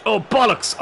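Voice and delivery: sudden high-pitched dismayed curse; real, startled swearing; dismayed outburst. Real, not acted. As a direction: blurt it out as the goal goes in. Opponent scores while he is talking.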